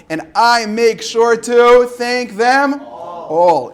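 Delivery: loud, slow storybook read; reading to children. Acted. Reading the book's last page aloud, loud and slow. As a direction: savor each rhyme for the kids.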